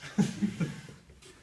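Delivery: unvoiced wheezy laugh at her own joke; breathless laugh. Real, not acted. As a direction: let a breathy laugh slip out.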